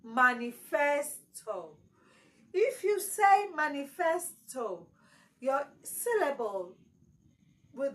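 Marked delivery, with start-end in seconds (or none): 0.0-1.8 slow manifesto with FES pushed up; wrong stress on FES. Shown as a demonstration. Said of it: "Some people will say manifesto". 3.6-4.9 manifesto with FES leaned on; wrong stress on FES. Shown as a demonstration. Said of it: "your stress will fall on the wrong syllable".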